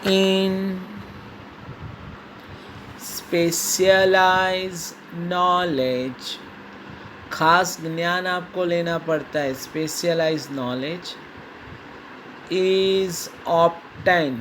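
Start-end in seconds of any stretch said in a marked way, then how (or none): 0.1-6.4 very slow word-by-word dictation; dictating. Shown as a demonstration. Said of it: "teacher dictating definition". 9.5-14.3 very slow word-by-word dictation; dictating. Shown as a demonstration. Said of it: "dictation continues".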